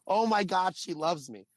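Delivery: high, giddy retold outburst; replaying a starstruck exclamation; thrilled disbelief. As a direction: burst out high, then ease down. Retelling his thrilled reaction.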